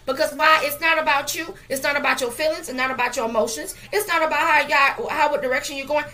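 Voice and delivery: fired-up pulpit cadence; preaching at full force. Acted. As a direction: rise into the climax of the sermon.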